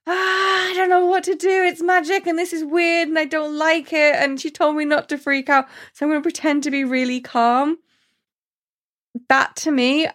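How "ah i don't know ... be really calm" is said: panicked inner voice. Retold or imitated. Flynn panicking inside while Rapunzel heals his hand, high frantic babble. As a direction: babble the panic in your head, then calm it. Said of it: "inside you can just see him going".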